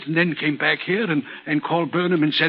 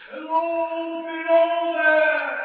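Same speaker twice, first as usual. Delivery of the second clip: voice crying out; 13 semitones higher, much slower than usual. Acted. Signalman recalling the voice he heard one night, distant crying out. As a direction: call out slowly, as if from far away. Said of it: "I heard a voice cry out".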